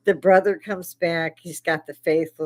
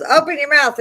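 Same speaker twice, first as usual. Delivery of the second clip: loud, high yelled order; wife yelling at her husband to open his mouth; retold yell; 8 semitones higher, 7 dB louder than usual. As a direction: bark it at him as you throw the water. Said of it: "grabs the bowl and yells".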